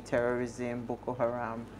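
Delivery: ordinary delivery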